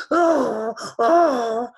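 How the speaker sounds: high, loud donkey brays; donkey scream. A narrator's reading. Teacher loses his nose, screaming like a donkey. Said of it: "He screamed like a donkey".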